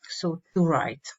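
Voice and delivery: ordinary talk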